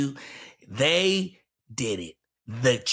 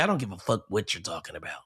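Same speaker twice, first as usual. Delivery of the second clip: quick, softer, offhand mock; 8 semitones lower, 7 dB quieter, faster than usual. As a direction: toss it off like it doesn't matter.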